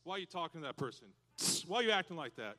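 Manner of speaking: usual speaking voice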